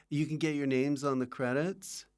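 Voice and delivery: usual speaking voice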